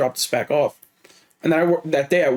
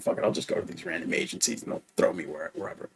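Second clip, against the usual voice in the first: resigned whisper; 11 semitones lower, 10 dB quieter than usual. Retold or imitated. Retells his own giving-up thought.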